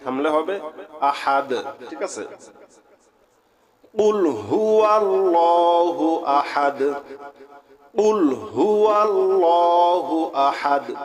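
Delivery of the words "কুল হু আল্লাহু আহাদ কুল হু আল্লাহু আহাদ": slow, drawn-out melodic tilawat; Quran recitation. Acted. Teacher reciting Surah Ikhlas to students, slow melodic chant. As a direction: chant each word long and even, like a qari.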